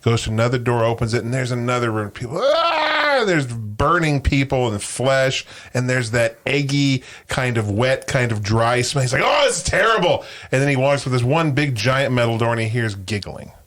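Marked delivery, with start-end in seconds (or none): 2.4-3.2 high drawn-out wail of agony; joke-telling scream, much higher pitched; mock pained scream. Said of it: "people screaming in pain". 9.2-10.2 high, mock-horrified exclamation; acted horror. Joke character horrified by hell.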